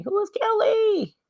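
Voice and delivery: a high startled who; surprised question. Real, not acted. Puzzled by an unknown message.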